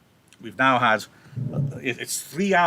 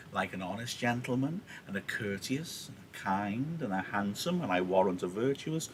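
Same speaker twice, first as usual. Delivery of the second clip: soft, low list, each item left hanging; string Juliet along gently; teasing suspense; 3 semitones lower, 8 dB quieter than usual. Acted. Nurse draws out the answer.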